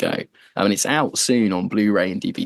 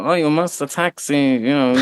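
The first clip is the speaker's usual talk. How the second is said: mock German accent, pitched higher; hammy German accent for a tank order; 5 semitones higher than usual. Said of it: "You'd have Oddball doing some German accent".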